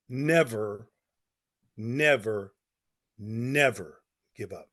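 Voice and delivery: low and slow, a pause after each never; slow, solemn emphasis. Real, not acted. Coach gives second most important principle, slow and solemn. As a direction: weigh down every NEVER.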